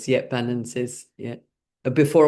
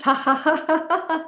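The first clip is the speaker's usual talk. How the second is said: forced laughter; 14 semitones higher than usual. Shown as a demonstration. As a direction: laugh it out on purpose, high up. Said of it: "So let's pack our suitcases".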